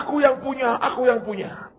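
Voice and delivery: put-on grab it like a greedy kid; child claiming. Boy claiming the ball from other children, high.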